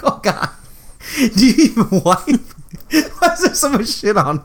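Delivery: a hint of a high disgusted yelp; mock disgusted character. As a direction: play the grossed-out guy, lightly hammed. Acting out the movie scene.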